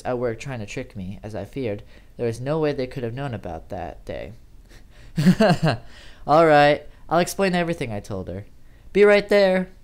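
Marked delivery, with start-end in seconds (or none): 4.4-6.2 loud nervous laugh of relief; relieved laugh. Acted. John relieved it is Amy, loud laugh. 6.3-7.9 louder, higher eager reply; relieved and loud. Acted. John answering Amy through the mic, relieved shout. 8.9-9.7 loud, high call. Acted. John calling to Amy at the door, loud call.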